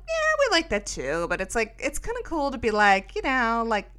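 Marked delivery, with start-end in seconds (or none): 0.1-1.3 mimicking teen girls. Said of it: "they're like".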